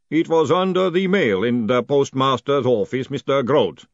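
loud, measured golem voice; matter-of-fact golem. Acted. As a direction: state it firmly as a dutiful golem.

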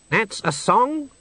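a snooty bird sneering; haughty swan. Acted. The swan scoffing at Death's song suggestion, high and disdainful.

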